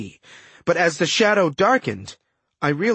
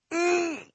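high, soft closed-mouth grunt; muffled grunt; 13 semitones higher, 6 dB quieter than usual. A narrator's reading. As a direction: grunt through a gag. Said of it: "I grunted".

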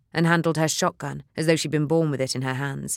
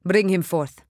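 commanding; 3 semitones higher, 4 dB louder than usual. Acted. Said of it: "he ordered".

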